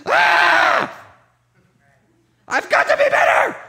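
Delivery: high strained shout of self-pressure; frantic shout. Pretend. Preacher mocking frantic striving with a shout. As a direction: yell it like someone desperate to do better.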